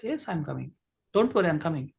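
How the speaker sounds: soft, relaxed reassurance; calm them down, unhurried; cool and calm. Shown as a demonstration. Cool friend replies to impatient caller, soft and relaxed. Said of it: "The other side person is cool".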